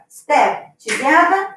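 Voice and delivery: slow dance cue rising on together; slow counted cue. Shown as a demonstration. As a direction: step together, drawn out slowly with a rising end. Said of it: "I'm just gonna step together".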